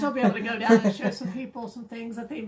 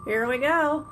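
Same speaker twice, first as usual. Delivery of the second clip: excited; 2 semitones higher, 7 dB louder, slower than usual. Real, not acted. Spinning the prize wheel.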